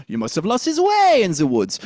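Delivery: French accent swooping up then down; French accent, varied pitch. Shown as a demonstration. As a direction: play around the range in a French accent. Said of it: "think about saying it different ways".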